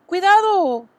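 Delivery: high, loud frightened shriek; Fern shrieks. A narrator's reading. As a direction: cry out in alarm for the pig. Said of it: "chilló Fern".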